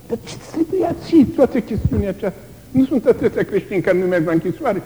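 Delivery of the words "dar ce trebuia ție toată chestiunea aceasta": inner doubt. Acted. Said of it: "îi vin așa niște gânduri".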